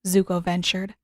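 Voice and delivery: ordinary delivery, nothing marked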